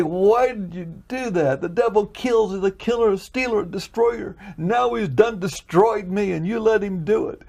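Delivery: wailing at God in betrayal; aching complaint. Retold or imitated. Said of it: "complaining, aching".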